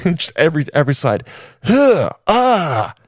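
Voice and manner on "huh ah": high gasp into a moan; gasp, then moan like a swooning crowd; hammed-up ecstatic gasp; mock moan. Shareholders moan at every slide.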